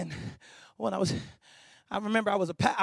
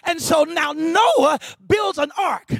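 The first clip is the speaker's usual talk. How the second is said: high, measured proclamation with pauses; impassioned preaching; 9 semitones higher, 7 dB louder, slower than usual. Real, not acted. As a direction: declare God's promise phrase by phrase. Preaches, voicing God to Noah.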